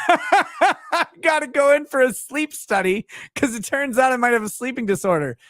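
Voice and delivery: high, laughing taunt; hammed-up gleeful I-told-you-so; mock gloating. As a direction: crow it triumphantly through put-on laughter. Gloating to manager about diagnosis.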